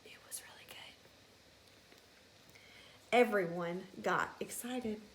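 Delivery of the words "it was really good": whispered fond memory. Real, not acted. Teacher recalling fresh apple juice, whispered. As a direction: savor it in a whisper.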